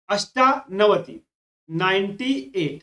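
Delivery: number word drawn out at half speed; slow dictation. Shown as a demonstration. Teacher giving students the word for ninety-eight, slow dictation. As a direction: stretch out अष्टानवति, part by part.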